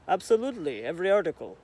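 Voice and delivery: lightly put-on, clumsy Russian accent; bad Russian accent. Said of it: "That was a horrible Russian accent".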